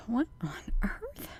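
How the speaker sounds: hushed "what on earth"; breathy whispered question; whispered puzzlement. Real, not acted. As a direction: whisper it, baffled. Screen confuses her.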